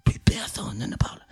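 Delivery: voiceless, dramatic whisper; low hush for comic suspense. Acted. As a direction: whisper it into a stunned silence. Repeats it to act the silence.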